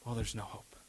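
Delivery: very quiet, low resignation; hopeless attitude. Acted. Believer who bought into the lie, quiet hopeless resignation. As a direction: play defeat, sunk almost to nothing. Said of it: "attitude of".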